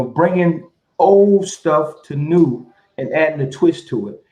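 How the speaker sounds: ordinary talk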